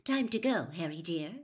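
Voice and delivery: usual speaking voice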